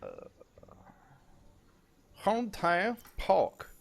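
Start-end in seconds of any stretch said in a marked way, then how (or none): 2.2-3.7 high, slow mock Chinese accent; put-on Chinese accent. Shown as a demonstration. Co-caster attempts a player's name, mock Chinese accent. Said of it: "I had to put in the accent".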